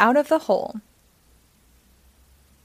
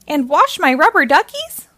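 higher, louder cartoon duck voice; Donald voice; 5 semitones higher, 7 dB louder than usual. A narrator's reading. Donald asking Minnie for help, fussy duck voice. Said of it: "says Donald".

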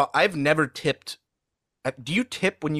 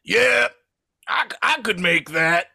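mock cocky Rob Schneider; 5 semitones higher, 4 dB louder than usual. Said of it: "and was like".

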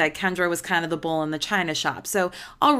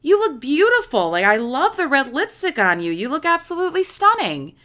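gushing compliment; 8 semitones higher, 5 dB louder than usual. Retold or imitated. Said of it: "he goes".

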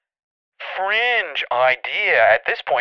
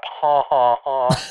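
ordinary talk, nothing marked

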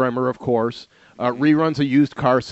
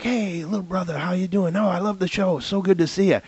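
Muhammad Ali impression; 3 semitones higher than usual. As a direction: greet him like the friendly champ. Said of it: "that's not a bad Ali".

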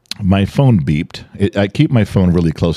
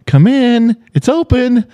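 high-pitched mock call through a door; pitched-way-up retold holler; imitated yelling. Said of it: "And you're yelling".

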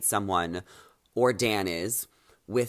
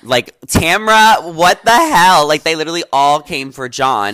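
much louder, higher outburst; loud indignation; 5 semitones higher, 12 dB louder, slower than usual. Real, not acted. Host scolding Tamra for going after John, loud indignant outburst. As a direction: call them out, incredulous and loud. Outraged on a cast member's behalf.

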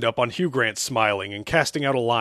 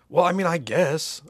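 higher, reluctant concession; half-hearted, pitched-up agreement; shrugging; 6 semitones higher, 3 dB louder than usual. Acted. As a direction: give in with a verbal shrug. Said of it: "I shrugged".

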